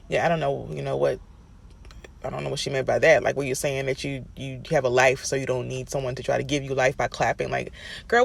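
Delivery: normal talking voice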